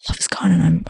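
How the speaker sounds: breathy whispered lament; mock-tragic whisper; whispered gloom. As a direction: whisper it like a broken heart. Voicing her broken self.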